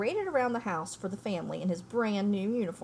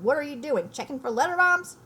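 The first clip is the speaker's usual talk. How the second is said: loud and higher, jeering; mocking shout; 6 semitones higher, 7 dB louder than usual. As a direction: call out a taunting joke, loud and high. Uncle Vernon mocking from kitchen.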